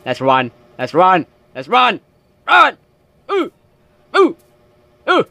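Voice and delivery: from calm to a high panicked shout; rising panic, ending in a yell; building to a scream. Real, not acted. Panicked flight from a sound.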